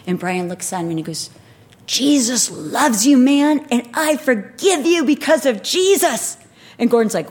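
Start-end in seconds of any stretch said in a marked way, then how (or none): 1.8-6.4 loud, high, strained shout of forgiveness; raised voice, punched word by word; forceful declaration. Retold or imitated. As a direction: imitate shouting forgiveness at an attacker. Retells Brian answering man cursing him.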